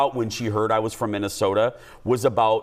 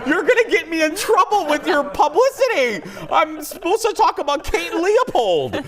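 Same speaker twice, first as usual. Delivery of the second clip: laughing mock protest; 4 dB louder than usual. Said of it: "And I'm like".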